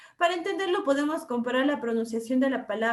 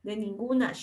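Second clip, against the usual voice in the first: De ninguna as a coastal Ecuadorian actually says it, clipped and quick; lightly imitated coastal Ecuadorian accent; 3 semitones lower than usual. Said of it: "como en realidad pronuncia".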